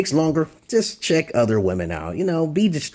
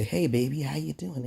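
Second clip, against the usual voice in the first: soft, timid pickup line; weak talk; 3 semitones higher, 7 dB quieter than usual. Shown as a demonstration. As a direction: say it meekly, fading out. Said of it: "You talk, that, say".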